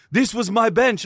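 high, loud territorial protest; imitating an angry gym rival; indignant claim. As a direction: act out a man staking his claim. Retold other man's angry claim.

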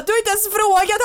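high-pitched mock protest; reenacted indignant yell. Fiancée replaying her answer to the stressed proposer, high mock yell. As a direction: protest back at full squeal, in retelling. Shouted proposal exchange retold.

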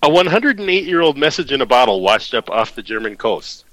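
ordinary delivery

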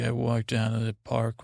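ordinary delivery

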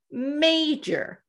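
MAJOR drawn out slowly for weight; emphatic MAJOR. Retold or imitated. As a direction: stretch the word MAJOR. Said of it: "she reemphasizes".

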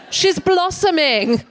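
high, slow, drawn-out mock primness; mock prissy voice. Comedian mocking the word blossoming with a high, slow voice. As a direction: lift it high and savour the word like a prim aunt.

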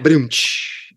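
spoken drum-and-cymbal sting; imitated rim shot. Host punctuates his own bad pun, imitated rim shot. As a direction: mouth a comic rim shot. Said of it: "Where's my rim shot?".

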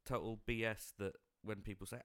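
ordinary talk

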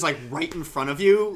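normal talking voice

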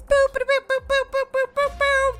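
high booped trumpet fanfare; high-pitched vocal horn boops; mock news jingle. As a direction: toot the closing jingle with your mouth. Sign-off news jingle by mouth.

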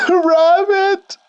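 high, piercing howl of pain. Acted. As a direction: howl the word like a wounded animal. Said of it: "he howled".

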